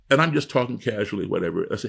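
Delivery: ordinary talk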